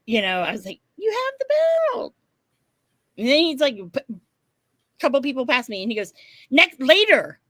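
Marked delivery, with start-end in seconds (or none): 1.0-2.1 excited squeal. Retold or imitated. Retells own excited reaction to belt. 6.5-7.4 called-out brush-off. Retold or imitated. Quotes him putting off passing people.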